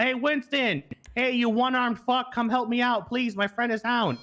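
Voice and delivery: mild yell for help, pitched well up; mock yell. Dispatcher showing caller how to summon the cop, mock yell. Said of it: "Just, just yell out".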